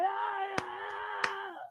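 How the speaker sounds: high, drawn-out "ah"; mock drunken squeal of joy; drunken elated wail. Wasted guy celebrating the moon, high elated wail. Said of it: "blitzed out of his mind".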